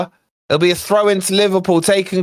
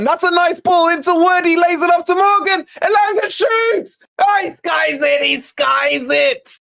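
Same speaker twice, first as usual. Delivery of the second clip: rising excitement; 6 semitones higher than usual. Real, not acted. Fan commentating on a Forest chance, building to shouting. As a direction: pitch climbing into a full excited shout.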